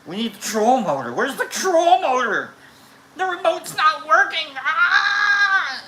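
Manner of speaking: frantic yell climbing into a scream; mock panic. Storyteller acts out the motor remote failing, escalating mock-panicked shouting. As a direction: build from loud high demand to hammed-up scream.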